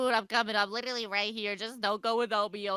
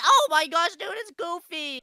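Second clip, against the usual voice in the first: loud, high burst of surprise; startled exclamation; 6 semitones higher, 7 dB louder than usual. Pretend. Player suddenly spotting Goofy, startled and loud.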